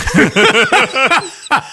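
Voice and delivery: high burst of laughter; loud laugh. Real, not acted. Laughing at the joke.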